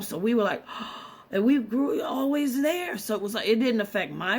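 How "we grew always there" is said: shocked gasp. Retold or imitated. Shocked reaction to scandal.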